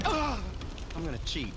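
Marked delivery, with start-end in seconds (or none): 0.0-0.4 short high shout with effort; a sudden loud battle cry; sharp fighting yell. Acted.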